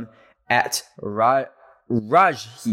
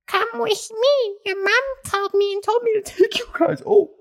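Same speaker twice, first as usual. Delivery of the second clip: put-on creepy old woman. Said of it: "she goes and says".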